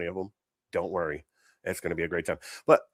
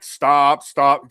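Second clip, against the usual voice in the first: a pleading, whiny stop, stop; 3 semitones higher, 8 dB louder than usual. Retold or imitated. Mocking the one bystander who begs the fighters to stop, whiny. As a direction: beg them to stop like a nervous bystander. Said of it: "that one dude that's like".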